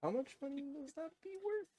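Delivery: put-on old man voice. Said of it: "All in character's voice".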